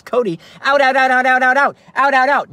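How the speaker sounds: loud high string of "out, out, out"; frantic repeated shout. Acted. Frazzled dad yelling at son Cody, loud frantic "out, out". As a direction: bark "out" again and again, frantic.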